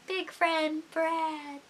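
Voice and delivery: high, soft, very slow repeat; mock wistful. Missing a friend not seen in a while, playfully wistful. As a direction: stretch each word out, hushed and high.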